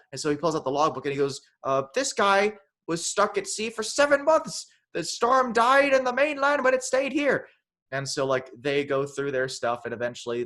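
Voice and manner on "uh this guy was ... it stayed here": high, loud hammed-up proclamation; ranting old keeper. Keeper reading the logbook aloud, mock high-pitched rant. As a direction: declaim it like an overblown film character.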